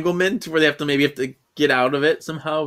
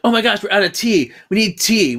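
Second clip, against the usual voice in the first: higher, louder fuss over tea; mock panic; 7 semitones higher, 5 dB louder than usual. Marcus panicking to friends about running out of tea, higher and louder. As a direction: fret like tea is an emergency. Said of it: "then Marcus is like".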